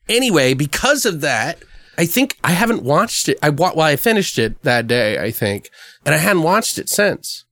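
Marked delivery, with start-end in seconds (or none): none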